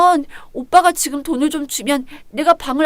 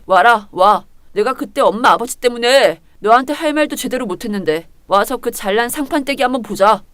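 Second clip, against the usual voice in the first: ordinary talk, nothing marked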